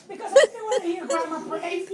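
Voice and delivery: loud, choked half-laugh while crying; laughing through tears. Real, not acted. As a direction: break into a tearful laugh. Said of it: "Why you crying now?".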